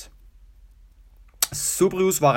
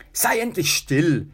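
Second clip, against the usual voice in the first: higher-pitched anxious plea; urgent pleading; 4 semitones higher than usual. A narrator's reading. Tertius begging his brother to stop, urgent pleading. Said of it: "flehte Tertius seinen Bruder an".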